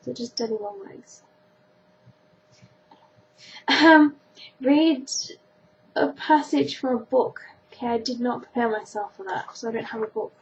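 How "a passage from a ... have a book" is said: ordinary talk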